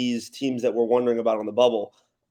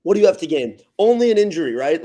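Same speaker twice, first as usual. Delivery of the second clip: louder and higher, fast and insistent; worked-up rhetorical question; 6 semitones higher, 7 dB louder than usual. Real, not acted. Podcast host pleading with his cohost that Gonzaga skip the tournament, loud and worked up. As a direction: press the point hard and excitedly. Pitching a heated argument to cohost.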